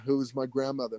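ordinary talk

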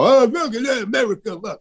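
high, mocking sneer of a smug elitist. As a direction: sneer at him like the ones who called him an ogre. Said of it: "knuckle-dragging primitive ogre".

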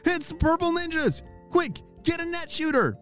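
high urgent alarm call. Acted. Alarm at ninjas in attic.

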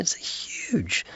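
hushed, grave whisper. Retold or imitated. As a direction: lean in and whisper it. Stresses the gravity of death.

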